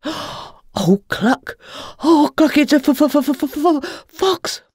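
high, stuttering panic; high, loud frightened clucking at the fox; panicked hen. A narrator's reading. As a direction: squawk and stammer in terror. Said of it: "flapped Henrietta".

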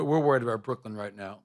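ordinary talk

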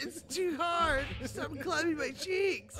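high, drawn-out pretend wail; mock pleading. Player being slapped by the cop, mock pleading. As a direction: plead slowly in a hammed-up high voice.

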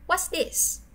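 ordinary delivery

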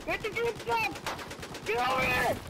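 high, drawn out excited yell; excited callout. Real, not acted. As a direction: call it out with a rush.

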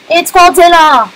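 mock alarm. Gamer spotting lizard man, mock alarmed. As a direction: cry out at a giant monster.

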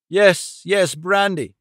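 acted frantic cry; urgent cry. A narrator's reading. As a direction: cry out for help at once. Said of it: "he cried".

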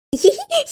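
short, high rising giggle; mocking giggle. Laughing at friends for being fools, mocking giggle.

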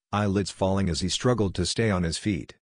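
usual speaking voice

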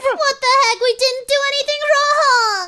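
outraged shout. Acted. Being arrested unfairly.

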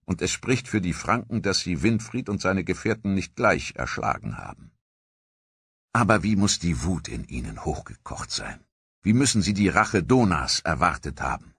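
plain reading voice

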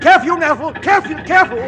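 high, loud cry of warning; alarmed shout. Acted. Ghost warning his nephew on the jeep. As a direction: yell for him to take care.

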